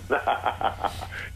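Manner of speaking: sudden evil cackle, unvoiced burst; the signature laugh. Shown as a demonstration. Ted shows the interviewers his trademark cackle. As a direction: let the famous laugh rip. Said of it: "and I go".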